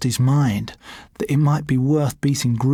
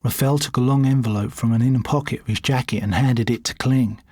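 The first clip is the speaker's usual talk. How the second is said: plain reading voice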